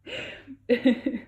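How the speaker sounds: short charming laugh, falling; a light laugh to soften it; bright falling chuckle. A narrator's reading. Fiero laughs after his confession.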